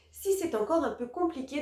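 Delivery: normal talking voice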